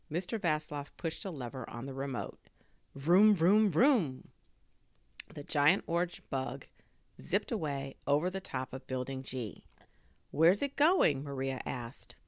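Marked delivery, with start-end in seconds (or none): 2.9-4.3 loud, raised "vroom" engine imitation; race car engine noise. A narrator's reading. Drone flying off like a race car, loud revving. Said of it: "sounded like a race car". 10.3-11.2 higher, louder question; alarmed neighbour. A narrator's reading. Neighbour watching drone zip away, high alarmed question. Maria asks as drone flies off.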